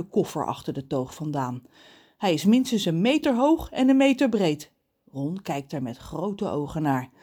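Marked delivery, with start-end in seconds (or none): none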